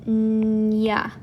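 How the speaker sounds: "ñ" as a long humming n gliding into "ya"; held ñ sound. Shown as a demonstration. Teacher isolating the sound for learners, long drawn-out "ñ". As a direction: hold the n, then release "nya" for "ñ". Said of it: "gives us that ñ".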